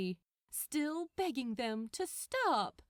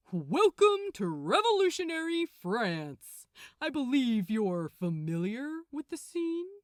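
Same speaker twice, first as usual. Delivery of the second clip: announcing; 3 semitones higher, 7 dB louder than usual. Acted. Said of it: "Gabriel announced".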